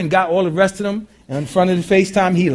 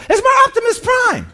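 put-on much higher, loud child yelling with joy; excited shout; 5 dB louder than usual. Little boy running to the postman, shouting with joy. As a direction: scream it running to the door.

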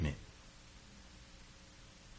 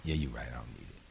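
son backing down, softer; 20 dB quieter, faster than usual. Retold or imitated. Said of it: "he said".

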